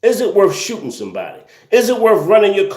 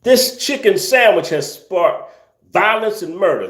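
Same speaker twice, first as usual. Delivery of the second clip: normal talking voice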